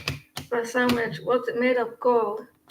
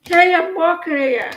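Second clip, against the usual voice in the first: loud, higher New York street holler; Yankee accent; 5 semitones higher, 5 dB louder than usual. Acted. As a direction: shout it like an annoyed New Yorker. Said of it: "Yankee accent".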